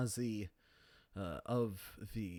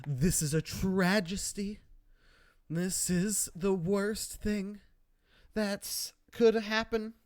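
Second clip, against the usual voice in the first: mock outrage; 6 semitones higher, 5 dB louder than usual. Mocking the show's mourning of Mountbatten, hammed-up outrage. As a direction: overplay the grief like a soap opera. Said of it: "they milk that for a while".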